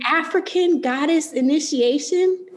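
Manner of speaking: high, overcome with emotion; close to tears. Real, not acted. Honouring her friend's book, close to tears. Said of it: "I'm gonna cry".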